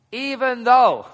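EVEN THOUGH high and very loud; emphatic exclamation. Real, not acted. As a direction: burst out on "even though" as the astonishing catch. Preacher stresses the surprising concession.

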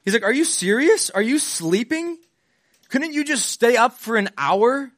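high, incredulous scolding of a sleepy friend; exasperated Jesus. Retold or imitated. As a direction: roll your eyes at the sleeping disciple, pitch up. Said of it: "Simon, he goes, like".

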